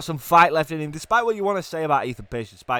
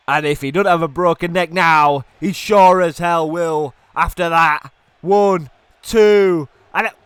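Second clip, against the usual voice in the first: loud, raised, building to the count; excited shout; 5 semitones higher, 8 dB louder than usual. Real, not acted. Commentator counting a near fall, excited shout. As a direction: punch it out, then shout the count.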